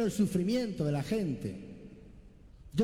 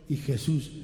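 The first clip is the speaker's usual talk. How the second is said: ordinary talk, nothing marked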